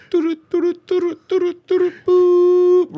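high-pitched electronic doo-doo beeps; machine beeps. Retold or imitated. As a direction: imitate a machine beeping, ending on a loud boop. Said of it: "That machine would have hit".

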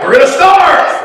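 high, loud imitated wail of complaint; mock outcry. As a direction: cry out as the panicking crowd, hammed-up. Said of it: "They cried out".

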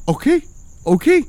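put-on a high, pleading drunk; mock drunk neighbour. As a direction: squeak the okays like a drunk friend retreating. Drunk neighbour backing away again.

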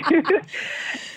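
a high, airy laugh with no voice; breathless laugh. Real, not acted. Guest laughing that she planned to lie, airy high laugh.

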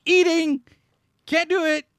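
high loud burst, mouth full; loud exclaiming. Real, not acted.

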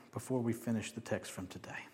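whisper. Real, not acted. Preacher drops to aside.

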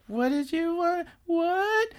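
very high, small shy voice; Hishinomori. Retold or imitated. Gamer girl taking off headphones, high shy question. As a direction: imitate a shy girl, slow and squeaky. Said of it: "she's all shy".

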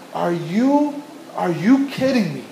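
normal talking voice

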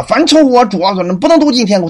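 hammed-up threat to a weak believer; mock scare. Voices someone scaring with scripture.

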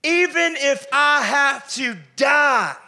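high, loud, slow protest; exclamation. Acted. Peter loudly protesting to Jesus he won't fall. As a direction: cry it out, refusing the very idea. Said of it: "Peter says with exclamation".

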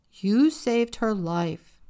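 slow, loud fatherly rebuke; stern father. A narrator's reading. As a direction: lay down the law to his daughter. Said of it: "her father said sternly".

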